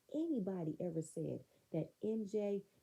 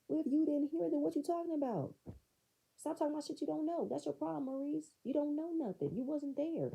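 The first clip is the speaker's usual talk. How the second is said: high, heated rebuke; indignant telling-off in a raised pitch; acted angry retort; 9 semitones higher than usual. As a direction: argue back high-pitched and insistent. Voices argument with Maurice.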